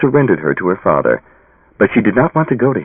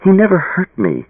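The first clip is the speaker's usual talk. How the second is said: protest; 11 semitones higher than usual. A narrator's reading. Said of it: "tried to protest".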